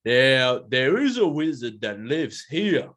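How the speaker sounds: slow, wary gorilla voice; pondering creature, a touch of distrust; suspicious gorilla. Acted. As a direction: mull it over suspiciously, drawing out the words. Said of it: "start getting a bit suspicious".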